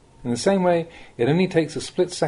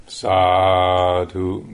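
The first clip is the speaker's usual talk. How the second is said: low, drawn-out mock chant of Sadhu; lay people's Sadhu; 7 semitones lower, 3 dB louder than usual. Monk imitating lay people answering a blessing, low drawn-out chant. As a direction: imitate the lay crowd's pleased response. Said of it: "they all say".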